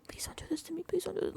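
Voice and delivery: soft, high mock begging; [name]. Housemate doing his little guy act, mock pleading. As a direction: plead in a small pitiful voice. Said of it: "[name]'s little".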